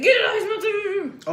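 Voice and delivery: high, slow alarmed cry; panicked plea. Retold or imitated. Wife retelling a baby scare, panicked high plea. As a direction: reenact the panic over the baby. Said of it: "I was like".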